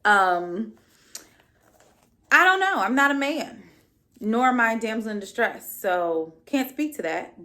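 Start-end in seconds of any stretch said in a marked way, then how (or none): none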